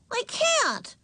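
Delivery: put-on very high, childish protest; angry shout. A narrator's reading. Ramona answering Mother above barking, angry high shout. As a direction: cry it out high and cross. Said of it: "shouted Ramona angrily".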